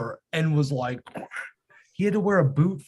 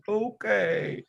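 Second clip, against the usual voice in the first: put-on pitiful kid; 5 semitones higher, 3 dB quieter than usual. Son's reluctant reply acted out.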